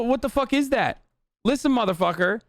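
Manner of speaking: ordinary talk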